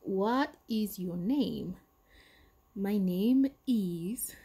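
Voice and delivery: "What is your name? My name is" said very slowly; slow model dialogue. Shown as a demonstration. Teacher demonstrates the exam answer, very slow. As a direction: draw each word out for beginners. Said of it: "teacher models exam dialogue".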